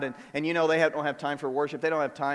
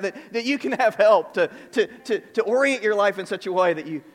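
higher-pitched, emphatic sermon delivery; impassioned preaching; 6 semitones higher, 7 dB louder than usual. Real, not acted. Pastor reminding strugglers they needn't do it alone, impassioned preaching. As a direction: urge them on in a raised preaching voice.

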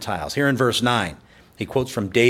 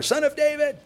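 much higher, straining cry; calling out louder. Retold or imitated. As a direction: cry out over the crowd's hushing. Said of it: "But he called out louder".

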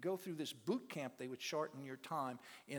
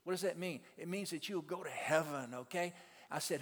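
ordinary talk, nothing marked

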